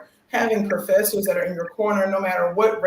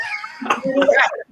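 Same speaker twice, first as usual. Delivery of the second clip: shrieking laugh. Real, not acted. Laughing hard at her friends' teasing reply, a high shrieking laugh.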